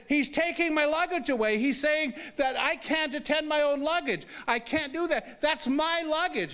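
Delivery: high-pitched insistent complaint; assertive. Retold or imitated. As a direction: state your grievance forcefully to an onlooker. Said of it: "Now, I can be assertive".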